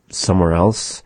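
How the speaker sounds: ordinary talk, nothing marked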